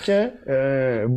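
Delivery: ordinary talk, nothing marked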